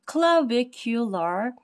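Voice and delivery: "clavicular" drawn out slowly, syllable by syllable; slow, careful pronunciation. Real, not acted. Teacher working through a hard anatomy term, "clavicular" said very slowly. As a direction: take your time sounding out "clavicular". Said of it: "pronounce it very slow".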